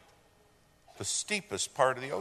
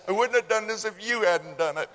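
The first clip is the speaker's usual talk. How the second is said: mock panicked blame; 9 semitones higher, 6 dB louder, faster than usual. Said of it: "And then he says".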